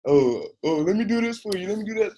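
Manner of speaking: high, put-on fussing voice; exaggerated high-pitched offer of help; mock helpful coworker. Mimics coworkers' patronizing offers of help.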